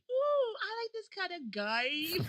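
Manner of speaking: put-on high, loud, drawn-out kid gushing; slow squeal with an oddly said "game"; odd childhood delivery. Woman imitating herself opening a Christmas game, high and drawn out.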